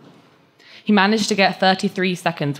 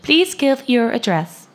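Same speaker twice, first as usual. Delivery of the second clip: slow, higher, louder machine voice; robotic voice; 9 semitones higher, 5 dB louder, slower than usual. Acted. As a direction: cut in like a rude automated bot. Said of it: "bot's robotic voice".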